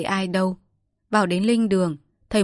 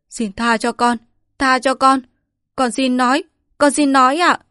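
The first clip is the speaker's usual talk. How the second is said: high, loud pleading, building; terrified servant gives in, pleading ever louder; begging for mercy; 6 semitones higher, 7 dB louder than usual. Acted. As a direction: plead with a knife at your chest. Knife at his skin, begging.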